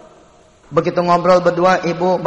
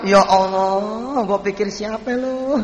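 relieved surprise; 3 semitones higher, much slower than usual. Acted. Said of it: "Apa kata si Malik sambil melok nih tamu".

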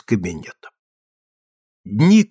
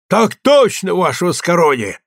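joyful soldier's report; 5 semitones higher than usual. Acted. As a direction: rap out the answer gladly like a private. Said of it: "радостно отрапортовал мушкатёр".

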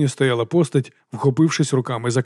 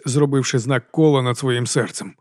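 plain reading voice

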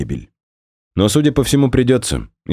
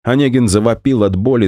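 plain reading voice

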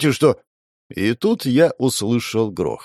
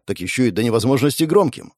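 ordinary delivery, nothing marked

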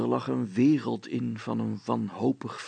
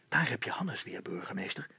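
hushed unvoiced whisper; 6 dB quieter than usual. A narrator's reading. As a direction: whisper so nobody hears. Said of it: "zei Brilstra".

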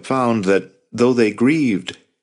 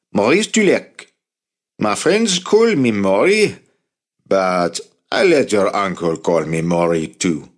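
put-on French accent. A narrator's reading. Maury joking to Jacob in a French accent. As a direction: give the name a French lilt. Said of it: "He spoke with a thick French accent".

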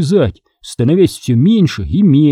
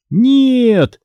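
yell; 10 semitones higher than usual. Acted. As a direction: cry out as the car flies off the road. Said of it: "завопил я". (испуганный вопль)

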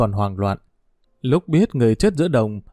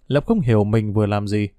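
ordinary delivery, nothing marked

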